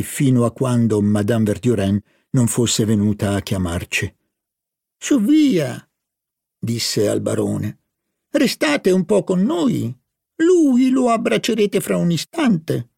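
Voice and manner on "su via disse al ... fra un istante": higher, coaxing professor's voice; urging the baron to stay; Brichot's coaxing. Acted. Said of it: "aggiunse Brichot". (voce alta e persuasiva del professore)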